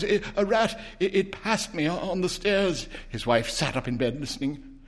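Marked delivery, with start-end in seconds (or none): 0.0-2.9 put-on stammering, frightened old man; shaking tones. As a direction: stutter it out in fear. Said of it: "said the old man in shaking tones".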